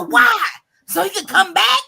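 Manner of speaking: high, loud challenge; acted outraged questioning; indignant retort. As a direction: fire back in disbelief. Retold argument with brother.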